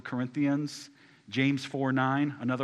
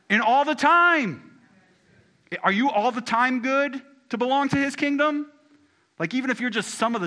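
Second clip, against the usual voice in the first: rising, louder preacher's climb; preaching at full force; 9 semitones higher, 8 dB louder than usual. Acted. Pastor to congregation, building to a loud, high preach. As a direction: drive it home from the pulpit, building higher.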